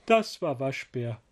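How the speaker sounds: lively picture-book read-aloud; reading to children. A narrator's reading. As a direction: tell it like bedtime for little ones. (lebhaft vorgelesene Kindergeschichte)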